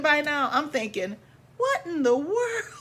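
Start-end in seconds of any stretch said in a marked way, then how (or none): 1.6-2.8 high squeal of laughing disbelief; laughing cry. Real, not acted. Narrator reliving the scolding, squealing with laughter. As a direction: shriek it through laughter.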